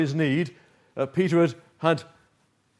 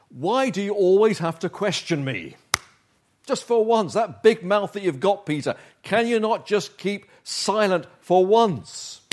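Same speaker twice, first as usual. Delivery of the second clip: higher, louder mock reproach; exasperated scolding; 7 semitones higher, 5 dB louder than usual. Preacher imagining Jesus losing patience with Peter, mock exasperation. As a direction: tick him off as if fed up with his interruptions.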